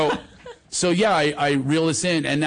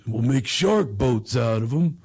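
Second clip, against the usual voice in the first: father impression. Father pitching shark boats from landing craft, father impression. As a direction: lay out the plan unhurriedly. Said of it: "he goes".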